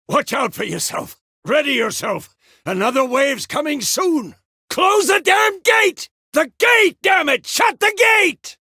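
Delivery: warning calls building to high, full shouts; urgent battle shout. Acted. Commander yelling to close the gate before the next wave, urgent shout.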